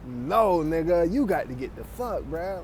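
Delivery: ordinary talk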